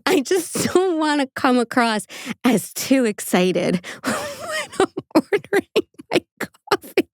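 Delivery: slow, breaking up into high laughter; losing it mid-sentence; laughing while quoting. Real, not acted. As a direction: dissolve into giggles as you quote him. Cracks up quoting husband's excuse.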